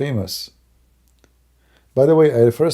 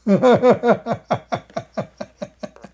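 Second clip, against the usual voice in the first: high, loud burst of laughter; 12 semitones higher, 4 dB louder than usual. Real, not acted. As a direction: laugh it off, bitter and big. Bitter laugh at his own life.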